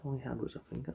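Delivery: usual speaking voice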